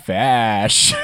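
announcer's Fash. Retold or imitated. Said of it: "if this man spoke the word".

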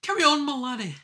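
high, loud hammy British voice; British accent. Pretend. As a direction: play a cheeky Brit. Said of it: "to do a British accent".

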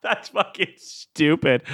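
laughing. Real, not acted. Laughing at own jingle.

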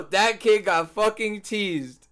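slow, drawn-out imitation of his friend's line; teasing a friend by slowly mimicking him; mocking a friend. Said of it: "you go".

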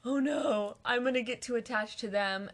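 a touch of imitated alarm, lightly voiced; half-hearted mock fright at her own feelings; mock panic. Retelling her younger self's fear of attachment, mild mock panic. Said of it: "get scared and be like".

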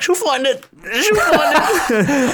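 very high, squeezed re-enactment of being polite; mock high-pitched polite endurance; strained politeness. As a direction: squeak it out politely through pain, drawing out the repeat. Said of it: "but I wanted to be polite".

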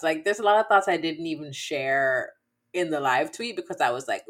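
ordinary talk

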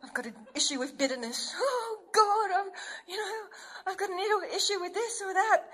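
high, tearful panicked confession; imitating old panicked self; panicking. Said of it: "I used to panic".

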